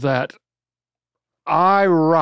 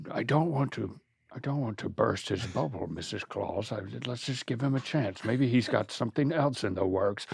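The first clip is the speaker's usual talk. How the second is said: much softer, hushed aside; quiet aside; 5 semitones lower, 13 dB quieter, faster than usual. Acted. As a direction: turn away and say it under your breath. Santa aside to Mrs Claus.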